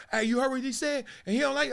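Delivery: high, eager gossip voice; gossipy instigator. Retold or imitated. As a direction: stir the pot, egging on. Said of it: "cats be coming up to me".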